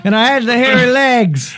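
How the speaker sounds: very high rambling politician impression; hammed-up, high-pitched reminiscing; Joe Biden. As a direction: chime in with a comic old-politician voice. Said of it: "Joe Biden".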